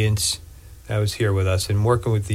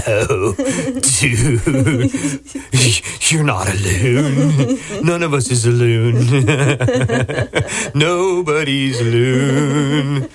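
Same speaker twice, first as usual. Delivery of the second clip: laughing; 3 semitones higher, 3 dB louder, slower than usual. Real, not acted. Laughing after loon call.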